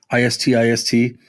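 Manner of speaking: ordinary talk, nothing marked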